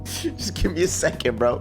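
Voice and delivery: normal talking voice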